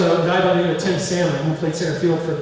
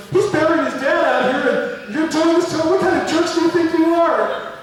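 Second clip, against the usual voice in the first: high, loud scolding outburst; mock angry rant; 11 semitones higher, 4 dB louder than usual. Coach berating players over teammate burying his dad, mock outrage. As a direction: yell in hammed-up outrage at a bunch of jerks.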